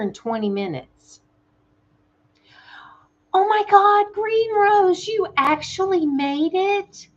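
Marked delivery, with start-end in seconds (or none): 3.3-7.1 high, loud, thrilled greeting; welcoming Green Rose to the stream with a high, delighted squeal. Real, not acted. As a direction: squeal with joy at a friend arriving. Viewer Green Rose arrives, delighted.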